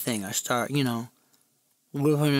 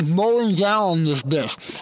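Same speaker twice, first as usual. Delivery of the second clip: ordinary talk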